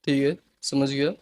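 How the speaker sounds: soft, quiet, calm acknowledgement; calm reply. Shown as a demonstration. To an angry parent, a soft calm agreement. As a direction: gently agree to defuse the anger. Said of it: "calmly reply दो".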